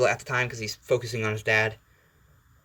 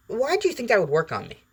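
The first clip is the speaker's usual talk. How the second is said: high, incredulous question; Deadpool; 11 semitones higher than usual. Acted. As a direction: start high and slide down, mock-baffled. Acted Deadpool, stabbed, questions Wolverine.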